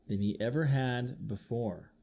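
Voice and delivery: ordinary delivery, nothing marked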